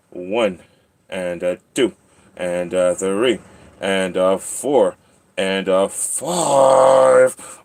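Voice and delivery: measured beats, big drawn-out final number; building singsong count. Pretend. Counting the cat's other side, building singsong. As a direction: count climbing in pitch and volume to the last.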